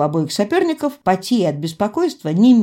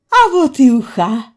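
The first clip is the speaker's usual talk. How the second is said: loud, higher, overjoyed cry; delighted exclamation; 6 semitones higher, 7 dB louder than usual. A narrator's reading. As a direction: exclaim with delight as the soup arrives. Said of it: "обрадовалась я". (радостный громкий возглас)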